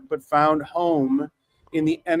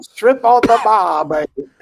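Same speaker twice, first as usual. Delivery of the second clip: Vegas lounge voice; 3 semitones higher, 7 dB louder than usual. Pretend. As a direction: croon it like a Vegas showman. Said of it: "why don't you do his voice for him".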